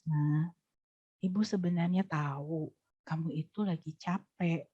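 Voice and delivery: put-on low serious voice to a tired child; low inward voice. Shown as a demonstration. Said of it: "rendah kan suaranya".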